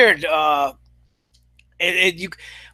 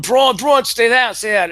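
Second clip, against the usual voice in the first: higher, louder pleading stay down; urgent shouts; 5 semitones higher, 4 dB louder than usual. Retold or imitated. Kurt Angle telling Braun to stay down, mock urgent shout. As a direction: shout a warning to a hurt friend. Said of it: "telling him".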